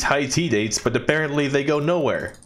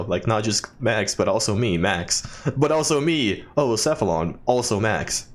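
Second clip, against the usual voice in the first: usual speaking voice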